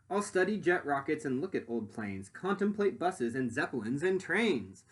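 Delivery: lively rhyming storybook read; reading to children. Acted. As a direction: read it out to the class with bounce. Reads picture book aloud.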